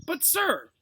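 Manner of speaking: outraged protest. Acted. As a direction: protest the injustice. Ron protests unfair order.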